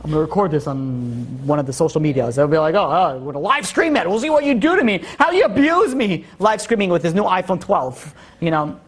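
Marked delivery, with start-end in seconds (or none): 2.7-6.2 higher, mock taunting kid; taunting child. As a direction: needle the father like a smug kid. Child taunting father with live stream.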